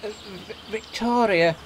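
hesitant, stammering shy reply. A narrator's reading. Old coach telling the driver her name, shy. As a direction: falter on the first sound, then get it out. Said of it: "replied the coach shyly".

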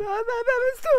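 high, wailing, scared question; whimpering at a robber; terrified stammer. Acted. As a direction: stutter it out high in fear. Held up by a robber.

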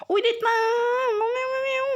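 very high, crying-out squeal of joy; shrieking squeal. Real, not acted. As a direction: shriek with delight at the cat. Squealing over a cat item.

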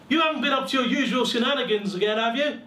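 high, loud and fast scolding; a mother catching her son out; suspicious mother. Acted. Mother questioning her son as he comes through the door, fast scolding. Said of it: "asked my mother".

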